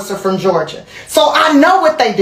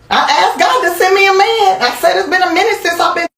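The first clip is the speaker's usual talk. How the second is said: comic plea; 8 semitones higher than usual. Real, not acted.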